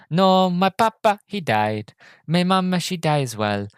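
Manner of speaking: put-on innocent Italian accent, high then dropping; mock Italian with high starts, falling each time. Passenger explaining his parents died, innocent Italian accent. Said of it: "He goes".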